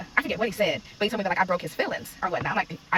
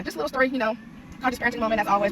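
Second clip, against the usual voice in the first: normal talking voice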